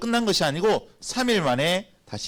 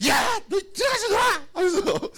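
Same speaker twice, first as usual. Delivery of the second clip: exasperated yell; 14 semitones higher than usual. Retold or imitated. Dad retelling how he yelled at his children, exasperated shout. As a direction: snap and yell at noisy kids. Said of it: "소리 퍽 질렀거든요".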